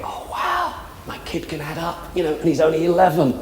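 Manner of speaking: high, breathy, hammed-up wonder; mock parental amazement. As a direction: gush like a proud parent, tongue in cheek. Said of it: "you think".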